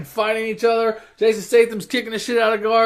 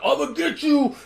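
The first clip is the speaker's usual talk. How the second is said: high mock Rock threat; a hammed-up vow of revenge; comic threat from a betrayed hero; 8 semitones higher than usual. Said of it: "The Rock's like".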